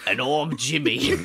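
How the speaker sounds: put-on British accent, laughing; British future voice. Co-host redoing his intro as his future self, British accent. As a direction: redo the intro as a Brit. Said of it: "Would you want to talk in my regular voice".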